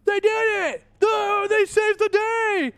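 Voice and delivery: high, loud hammed-up crowd cheer. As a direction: shout like an elated crowd hailing heroes. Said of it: "you hear the crowd go".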